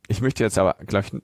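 ordinary talk

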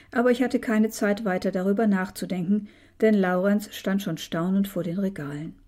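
ordinary delivery

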